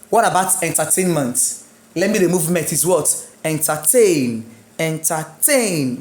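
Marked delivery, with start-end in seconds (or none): 3.4-6.0 "Entertain" slowly, last syllable tain drawn out; stress on tain. Shown as a demonstration. Teacher demonstrates the stressed syllable, "Entertain" stressed on tain. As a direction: lean long on TAIN in "Entertain". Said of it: "My stress on tain".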